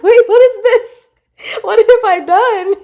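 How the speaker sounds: high, loud laughing panic; laughing what-have-I-done; mock alarm. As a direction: squeal in playful disbelief. Mock panic at own meme.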